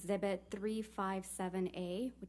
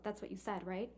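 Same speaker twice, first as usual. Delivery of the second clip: normal talking voice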